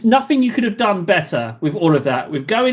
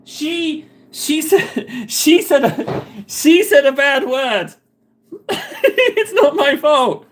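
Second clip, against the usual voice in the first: high, slow protest broken by laughter; laughing protest; 7 semitones higher, slower than usual. Real, not acted. Streamer blames guest for bad word, laughing protest. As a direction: blame her while cracking up.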